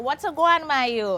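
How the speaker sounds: Jamaican patois, guan stretched; Jamaican saying. Shown as a demonstration. Host modelling the Jamaican greeting for the guest. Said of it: "you see how you're doing that".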